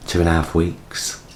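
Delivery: usual speaking voice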